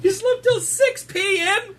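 high, slow disbelieving question; surprised. Real, not acted. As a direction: stretch it out in disbelief. Disbelief at sleeping till evening.

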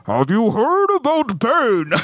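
slow, put-on Bane villain voice; slow menacing masked-villain voice. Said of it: "And then Bane comes in".

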